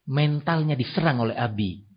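normal talking voice

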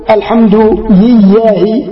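slow الحمد لله with the lam of لله said as ya, like alhamdu yiyah; lisp demo. Shown as a demonstration. Teacher demonstrating a lisping worshipper's reading, slow, lam as ya. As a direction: sheikh imitates a man whose tongue turns lam into ya. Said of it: "يعني اللام ينطقها ياء".

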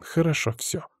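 softly muttered brush-off; grumbled. A narrator's reading. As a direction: mutter it curtly to end the subject. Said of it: "буркнула Наташа".

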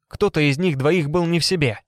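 ordinary delivery

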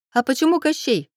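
a touch of giggly curiosity; Даринка. A narrator's reading. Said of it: "хихикнула сзади Даринка".

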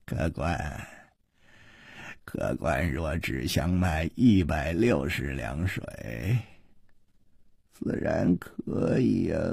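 low, soft, slow oily drawl; chuckle, then toy with the customer; quietly amused, unhurried shopkeeper; giggling, smug. A narrator's reading. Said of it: "掌柜的笑嘻嘻地说".

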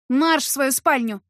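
loud, slow, menacing command; stern order. A narrator's reading. Heroine orders him to his bedroom, stern command. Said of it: "приказала я и грозно стукнула".

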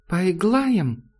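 put-on high childish lisp, inviting; little child's voice. A narrator's reading. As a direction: ask like a small child wanting to play. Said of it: "спрашивает в полутьме детский голосок".

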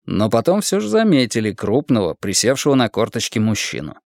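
usual speaking voice

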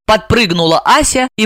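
ordinary delivery

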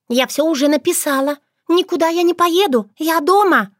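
high, firm little voice; firm refusal. A narrator's reading. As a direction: refuse him flatly. Shushana refuses her brother firmly.

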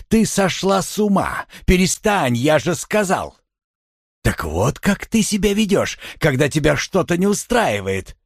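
high-pitched cross scolding; exasperated rebuke. A narrator's reading. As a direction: reproach her for losing control. Husband berates hysterical wife.

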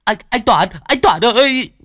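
high, frightened calling out; panicked cry. A narrator's reading. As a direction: call out in terror at the corpse. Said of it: "Thằng Sử hốt hoảng". (hoảng hốt gọi to, giọng cao)

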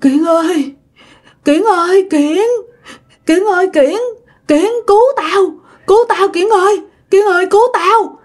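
acted frightened pleading, repeating the name urgently; trembling, urgent plea. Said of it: "cái giọng run run".

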